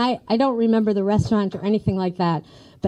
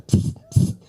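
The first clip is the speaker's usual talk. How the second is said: mock hiss. Aunt sneaking down hall.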